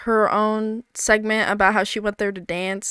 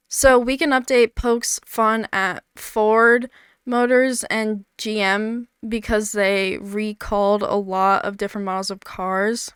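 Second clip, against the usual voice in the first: normal talking voice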